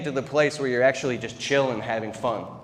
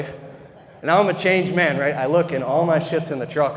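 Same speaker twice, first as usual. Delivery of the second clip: usual speaking voice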